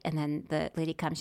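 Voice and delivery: ordinary talk, nothing marked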